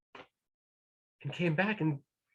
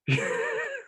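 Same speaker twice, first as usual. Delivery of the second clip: high laugh. Real, not acted. Narrator laughs.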